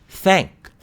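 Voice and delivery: isolated "thank", tongue out for the th; th pronunciation demo. Shown as a demonstration. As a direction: model the "th" that opens "thank". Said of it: "look at things like pronunciation".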